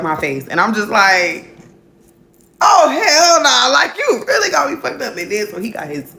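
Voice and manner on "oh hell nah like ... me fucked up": high, loud, drawn-out cry of disbelief; outraged outburst. Retold or imitated. Retelling her reaction to a smack across the face, outraged shout. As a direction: belt it out, high and indignant.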